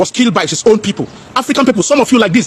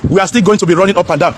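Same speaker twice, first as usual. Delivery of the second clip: ordinary talk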